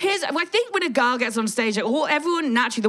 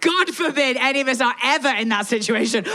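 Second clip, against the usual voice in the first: usual speaking voice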